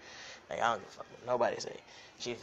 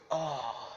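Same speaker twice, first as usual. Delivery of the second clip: mock moan; 4 semitones higher, 4 dB louder than usual. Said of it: "I was like".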